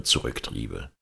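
plain reading voice

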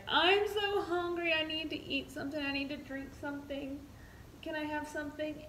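high, pleading mock whine; imitating whiny patient. Wife mimicking drugged husband begging for food, high whine. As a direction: beg for food like a loopy patient.

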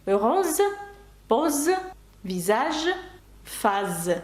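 French "Роз, поз, візаж, фаз", Z sounds, spaced apart; S read as Z. Shown as a demonstration. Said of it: "то це звук З".

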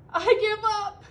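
loud, high wail of surrender; blubbering. A narrator's reading. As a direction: wail it like a defeated, weeping bear. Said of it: "he blubbered".

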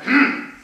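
loud, indignant grunt; short, forceful harrumph; angry Sultan's hmph. A narrator's reading. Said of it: "The Sultan went".

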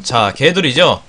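ordinary talk

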